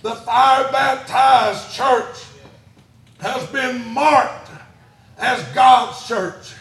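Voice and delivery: slow, punched preacher's phrases; forceful preaching. Real, not acted. Preacher declaring the church marked by fire, forceful preaching. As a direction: hurl out each phrase, then pause.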